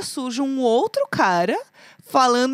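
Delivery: ordinary delivery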